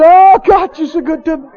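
high, loud opening cry of praise; reliving an overjoyed shout to God; joyful shouting. Retold or imitated. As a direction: cry out, then keep repeating it up high. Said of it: "I got up and I got to shouting".

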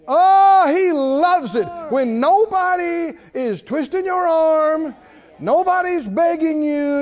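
loud, rising preacher's drive; hyped sermon delivery; full-force preaching. Real, not acted. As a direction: preach it hard to the congregation.

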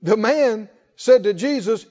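normal talking voice

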